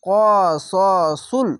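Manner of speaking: slow chanted কসসুল with a heavy স; Quran recitation. Shown as a demonstration. Teacher joins the spelled letters into recitation, slow chant. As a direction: hold কসসুল long and full. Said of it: "সদ লাম পেশ সুল".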